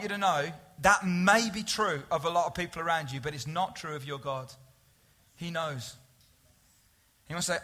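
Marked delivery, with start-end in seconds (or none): 5.4-6.0 soft and low; quiet reassurance. Real, not acted. Preacher comforting the congregation, soft and sincere. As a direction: let it settle gently.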